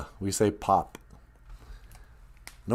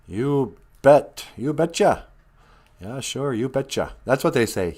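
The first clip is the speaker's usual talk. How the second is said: ordinary delivery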